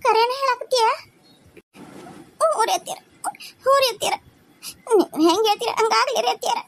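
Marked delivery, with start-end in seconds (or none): 2.4-6.6 higher voice with sobs, yes said through tears; weeping consent. Acted. Daughter-in-law agrees with mother-in-law, weeping. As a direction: agree while crying.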